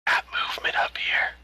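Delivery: hushed radio whisper. Acted. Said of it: "quietly and said".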